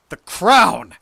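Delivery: high, explosive shout; full-voiced, impatient yell; furious shout. A narrator's reading. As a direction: roar the demand. Deranged narrator demands the crown.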